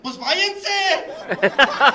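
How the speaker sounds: loud, high retold crowd shout, drawn out; crowd's angry shout. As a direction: yell it like an outraged crowd. Crowd jeering at the group.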